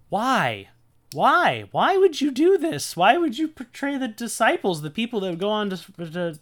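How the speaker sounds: high, loud, incredulous why; pleading, high-pitched bafflement; exasperated disbelief. Real, not acted. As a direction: protest in baffled, rising-pitched outrage. Bewildered complaint about the text.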